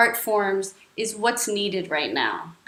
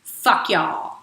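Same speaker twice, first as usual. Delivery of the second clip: demo line; 4 semitones lower than usual. Shown as a demonstration. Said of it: "practice going".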